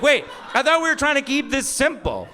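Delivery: high-pitched mock exasperation; hammed-up baffled complaint; confused protest. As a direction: protest in comic bewilderment, voice way up. Confused husband protesting wife's lecture.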